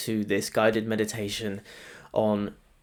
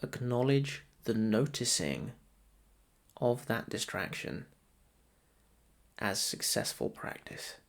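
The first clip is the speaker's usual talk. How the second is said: softer, measured meditation voice with long pauses; guided meditation; 7 dB quieter than usual. Acted. Meditation guide to listeners settling into breath practice, soft guided meditation. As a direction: guide them gently into noticing the breath.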